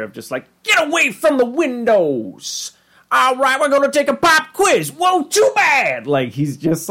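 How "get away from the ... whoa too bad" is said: in-your-face teacher, pitched way up; high, over-the-top schoolroom holler; Mister Goldenfold. Retold or imitated. In-your-face teacher orders the class into a pop quiz, high and hollering. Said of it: "he's just this in-your-face, like, teacher".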